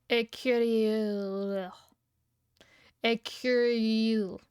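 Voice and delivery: slow careful try at French "écrire", rolled back r; sounding out "écrire" the French way; French attempt. Shown as a demonstration. Streamer practicing French for chat, careful French pronunciation. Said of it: "that's like an accent".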